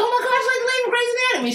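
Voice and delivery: high, fast, excited squeal of recognition; starstruck gush. Retold or imitated. To the nurse who looks like the Grey's Anatomy actress, a fast high excited gush.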